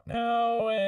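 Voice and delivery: fast snappy auto-tune; processed robotic voice; vocoder effect. Shown as a demonstration. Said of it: "now it's super fast".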